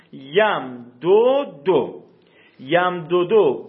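یم دو دو sounded out very slowly, syllable by syllable; slow classroom sounding-out; slow pronunciation demo. Shown as a demonstration. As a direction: stretch یم دو دو out so students hear each part. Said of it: "در مثال دومی دقت کنید".

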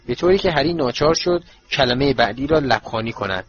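ordinary delivery

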